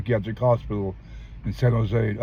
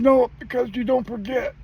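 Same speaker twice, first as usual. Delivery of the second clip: tearful mother; 13 semitones higher than usual. Retold or imitated. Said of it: "She started crying".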